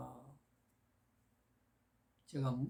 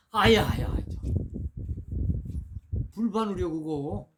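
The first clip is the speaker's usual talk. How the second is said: loud higher yelp of pain; acted pain cry; 3 semitones higher, 5 dB louder than usual. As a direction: cry out as the needle stings. Customer pricked by needle.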